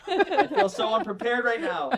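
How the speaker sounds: high, laughing through the words. Real, not acted. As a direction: giggle it out, embarrassed. Laughing at his failed accent.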